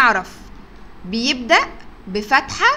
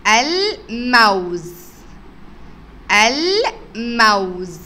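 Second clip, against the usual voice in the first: slow drawn-out word; much slower than usual. Shown as a demonstration. Teacher stretches الموز slowly for the children. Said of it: "نشوف كده الكلمة اللي بعدها".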